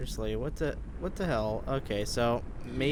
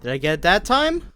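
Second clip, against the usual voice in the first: raised, impatient question; loud and fed up; exasperated; 4 semitones higher, 7 dB louder than usual. Real, not acted. Frustrated retry again.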